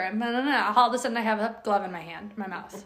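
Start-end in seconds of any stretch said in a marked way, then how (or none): none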